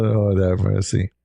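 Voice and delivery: ordinary talk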